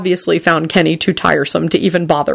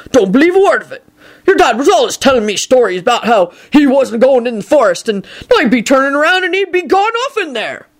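higher, animated rustic giant voice; suspicious Hagrid; 7 semitones higher than usual. Acted. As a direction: grumble a doubtful story, pitched up. Hagrid grumbles at Teddy.

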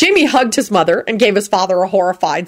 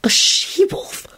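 high slow whispered question; 13 semitones higher, 3 dB quieter, much slower than usual. A narrator's reading. Elizabeth to Lupin, surprised whisper. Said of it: "Elizabeth whispered back".

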